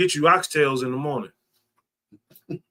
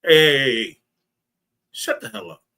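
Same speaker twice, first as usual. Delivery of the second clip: rude demo. Shown as a demonstration. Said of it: "You can say".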